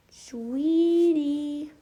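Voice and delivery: high drawn-out pet call; calling the dog. Real, not acted. Owner calls her puppy with a high drawn-out word. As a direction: stretch the word out sweetly, pitch up.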